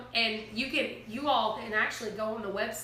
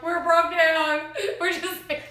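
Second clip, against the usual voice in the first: laughing; 6 semitones higher, 7 dB louder, slower than usual. Retold or imitated. Laughing panic to stranger.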